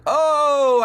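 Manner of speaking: loud, high, drawn-out "oh"; jeering "oh". Acted. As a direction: let out a big crowd-style ooh at the burn. Mock reaction to a burn.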